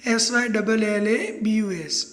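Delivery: slow letter-by-letter spelling of syllabus; spelled out slowly. Shown as a demonstration. Teacher spelling syllabus to compare with syllable, slowly. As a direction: spell each letter deliberately. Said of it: "उसका spelling भी थोड़ा similar".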